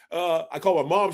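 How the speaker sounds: normal talking voice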